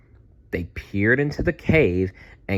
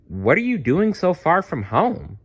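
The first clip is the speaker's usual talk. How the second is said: high, curious voice with a different timbre; gentle giant's question; 9 semitones higher than usual. Acted. Apatosaurus asks the lost little dinosaurs, in a raised curious voice. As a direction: ask the little ones kindly why they strayed.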